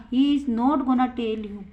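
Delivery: slow, clear example for students; teacher reading it out slowly to the class; slow model sentence. Shown as a demonstration. Teacher models the sentence, slow and clear. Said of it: "तो क्या बोलेंगे?".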